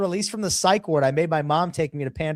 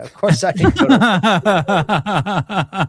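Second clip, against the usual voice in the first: slow, breaking into laughter; words melting into a laugh; retelling a comeback to the barber, laughing; 3 semitones higher, 6 dB louder, much slower than usual. Retold or imitated. Laughing through retold comeback.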